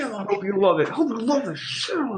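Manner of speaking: mock gloating.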